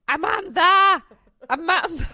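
loud, high exasperated yell of a name; shouting name. Retold or imitated. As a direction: bellow her name across the house. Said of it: "så skriker Alex".

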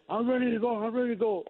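high, eager insistence; lightly voiced eager player; eager Hunter Pence. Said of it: "Hunter goes like".

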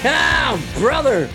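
normal talking voice

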